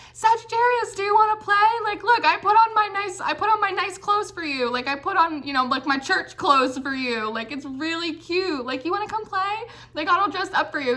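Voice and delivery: high, bouncy little puppy begging to play; eager puppy voice. Pretend. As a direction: squeak it out like an excited puppy in its best clothes. Puppy dog voiced in reading.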